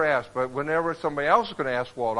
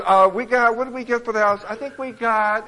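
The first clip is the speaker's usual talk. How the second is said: higher, louder, hesitant wife; lightly acted wife; 8 semitones higher, 7 dB louder than usual. Wife answering Peter about the house price, high hesitant voice. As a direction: stall aloud as if trying to recall. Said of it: "And she goes".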